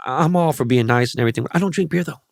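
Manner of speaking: ordinary talk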